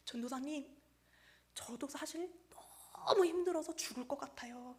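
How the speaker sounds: soft and halting, long pauses between phrases; imitated weary confession. Exhausted pastor confessing to the junior evangelist, soft imitated weariness. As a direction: let a retold admission out quietly, haltingly. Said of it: "그러면서 정말 엉엉 우시더래요".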